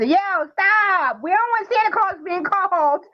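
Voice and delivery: put-on high, childlike scolding of his sister; a little boy voiced high, half telling off; imitating little boy. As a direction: play the young nephew warning his sister. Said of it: "he'll be telling his sister".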